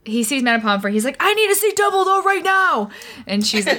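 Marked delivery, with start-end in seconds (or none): 1.2-2.9 higher mock-urgent demand; Harry demanding Dumbledore from the hospital bed, all caps. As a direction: bark it like a teen in all caps. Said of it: "There is a lot of all caps in this chapter".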